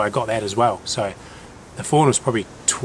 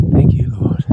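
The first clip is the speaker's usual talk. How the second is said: elated thanks; 6 dB louder than usual. Real, not acted. Thanks after the kill.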